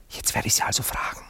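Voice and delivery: a fast, hushed aside; whispered aside. Acted. Anatol whispering to Max beside the sleeping Cora. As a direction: whisper quickly to your friend.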